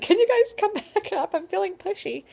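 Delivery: high, loud, laughing through the words; a giddy plea to the midwives between laughs. Retold or imitated. Mother in labor calls midwives back upstairs, laughing loudly. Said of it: "I was like".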